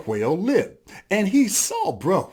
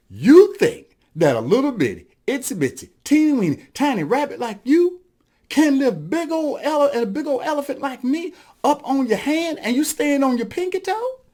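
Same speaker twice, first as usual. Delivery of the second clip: incredulous, climbing higher to the end; mocking disbelief; 9 semitones higher, 3 dB louder than usual. Elephant to tiny boasting rabbit, mocking disbelief. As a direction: build phrase by phrase to an astonished question.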